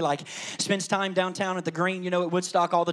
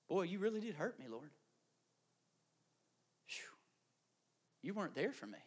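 soft, hushed confession of hurt; quiet hurt; 4 semitones lower, 15 dB quieter than usual. Acted. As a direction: murmur it like a wounded parishioner. Counseled person voiced quietly.

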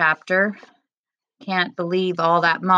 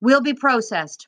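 urgent surrender; 6 semitones higher, 3 dB louder than usual. A narrator's reading. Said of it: "Mohammed told the guards".

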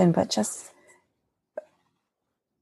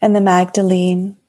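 guided meditation. Acted. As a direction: gently guide them into their hearts. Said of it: "would you like to, to lead us in something".

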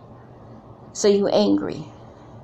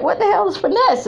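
higher, laughing disbelief; laughing confusion; 11 semitones higher than usual. Retold or imitated. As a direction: laugh through the question. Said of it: "And I was like".

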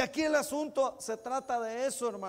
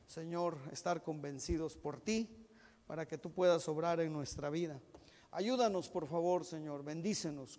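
prayer; 6 semitones lower than usual. Real, not acted. Closing prayer. (oración suave y humilde de cierre)

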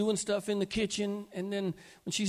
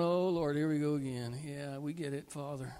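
low, much softer, fading sigh of fond exasperation; weary angels; 5 semitones lower, 3 dB quieter than usual. Acted. Angels teasing God about his stories, wearily fading. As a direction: roll your eyes and let it trail off. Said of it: "the angels are going".

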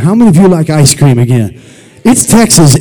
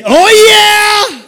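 much higher, loud oh yeah; shouted oh yeah; 4 dB louder than usual. Shown as a demonstration. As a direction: belt it out for the crowd.

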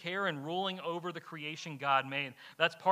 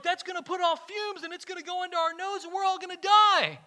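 much higher, rising to loud, hammed-up panic; panicking friend; 13 semitones higher, 8 dB louder than usual. Camping friend warning everyone will die from fumes, hammed-up panic. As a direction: sell the doom, over the top. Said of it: "He's like".

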